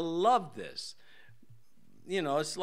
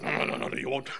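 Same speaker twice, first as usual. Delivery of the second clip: mock legalist; much faster than usual. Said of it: "They talk like that, don't they?".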